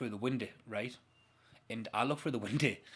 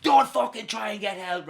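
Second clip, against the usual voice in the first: raised, forceful warning; shouted threat; 6 semitones higher, 8 dB louder than usual. Retold or imitated. Hijacker grabbing the driver's face, shouting a threat. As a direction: imitate an angry hijacker's loud threat.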